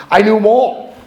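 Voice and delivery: high, loud proud boast; emphatic boast. Real, not acted. Speaker bragging to AA group, loud and proud. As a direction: brag it out to the room. Boasting about liquor stores.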